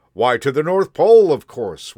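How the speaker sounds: loud, high, hearty conductor; booming conductor. A narrator's reading. Conductor telling the boy the destination, loud and hearty. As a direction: announce it proudly like a conductor.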